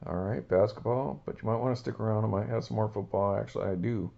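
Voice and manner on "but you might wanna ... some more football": ordinary talk, nothing marked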